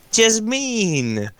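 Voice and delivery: high, drawn-out call in a put-on voice; put-on high-pitched impersonation; Casimir. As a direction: call out high, imitating someone else. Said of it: "do Casimir voice".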